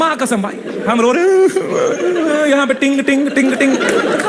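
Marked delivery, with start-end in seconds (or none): 0.9-2.5 laughing while telling. Real, not acted. Telling of crying call. 2.5-3.8 light repeated ting sounds; imitated message ping.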